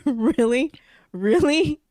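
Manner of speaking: can't believe he said that; rising laughing disbelief. Real, not acted. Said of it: "I'm literally telling you how pissed".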